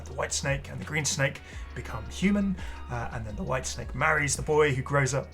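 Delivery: normal talking voice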